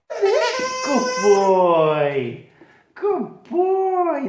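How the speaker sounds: high, bright-pitched praise for a dog; excited praise. Real, not acted. Owner praising her dog, excitedly high. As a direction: gush over the puppy.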